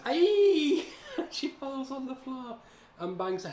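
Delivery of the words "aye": a sudden high, loud mock scream with a laugh in it; hammed-up shriek. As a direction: yelp like a cartoon girl falling over, laughing. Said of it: "And then she goes".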